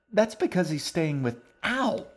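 put-on high girl's voice breaking into a sharp ow; girl squeezed by her brother mid-sentence; girl's yelp. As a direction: chatter then yelp in pain. Girl cut off, yelps.

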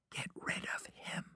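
voiceless whisper; mouthed words. A narrator's reading. Girl secretly pleads over the friend's shoulder, whispered. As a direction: whisper it, barely any sound. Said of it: "She mouthed the words".

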